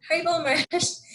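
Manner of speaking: imitating a high, eager call of greeting; excited greeting. Doctor greeting Maharaj at door.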